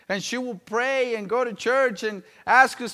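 ordinary talk

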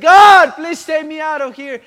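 loud, high desperate cry to God; very loud retold plea, slowing on the last call. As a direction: cry out for rescue, imitating her plea. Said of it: "she started crying and said".

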